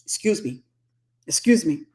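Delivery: imitating her husband's Cameroonian accent; polite Cameroonian-accented apology. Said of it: "He had the accent".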